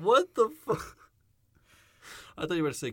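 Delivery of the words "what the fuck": a high, loud shocked outburst; real disbelief. Real, not acted. Cohost hearing "call me Gabe", a high loud real outburst. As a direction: yell at what you just heard.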